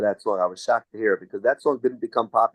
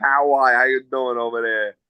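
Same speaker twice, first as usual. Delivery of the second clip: put-on New York accent shtick. Singer greeting everyone at camp, New York accent shtick. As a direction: play up the New York catchphrase. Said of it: "became like a shtick that I would do".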